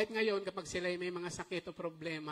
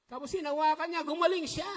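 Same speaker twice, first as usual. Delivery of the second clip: higher and louder, thrilled; excited; 9 semitones higher, 8 dB louder than usual. Acted. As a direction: announce the miracle with joy. Climax of the healing story. (masiglang pagbalita ng himala)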